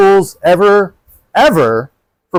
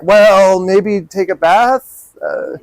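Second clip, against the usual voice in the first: slow, sing-song mock advice with a laugh; laughing mockery of tired self-help tips; 5 semitones higher, slower than usual. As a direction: drag out the clichés, amused and scornful. Mocking common self-love advice.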